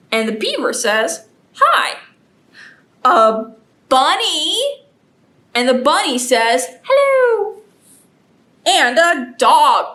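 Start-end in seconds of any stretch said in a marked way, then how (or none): 1.5-2.0 high little beaver hello; quick high-pitched animal greeting. Acted. 6.8-7.6 high, drawn-out bunny greeting; high-pitched storybook bunny. Acted.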